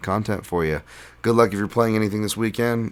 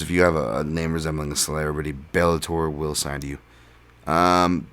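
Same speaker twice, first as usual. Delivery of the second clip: ordinary talk, nothing marked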